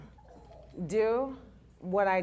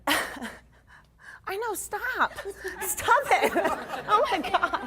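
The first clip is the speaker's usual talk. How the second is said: high, laughing protest; 13 semitones higher, 4 dB louder, much slower than usual. Real, not acted. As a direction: giggle through the embarrassment. Embarrassed as friends tease.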